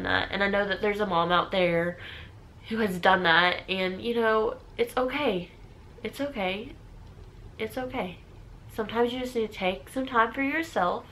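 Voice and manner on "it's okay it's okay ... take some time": softly reassuring. Real, not acted. Soothing other struggling moms.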